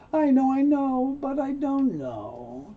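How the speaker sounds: high opening, then slow, drawn-out doubt; agree, then hesitate slowly; not sure. Acted. Man agreeing with her but reluctant, unsure. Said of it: "Well, I'm not sure".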